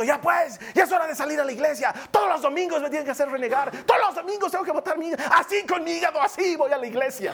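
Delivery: high, fast, hammed-up angry rant; exasperated family squabble. Spouse rushing the family off to church, exasperated. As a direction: nag and rant in mock fury, words rushing out. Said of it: "nos estamos matando".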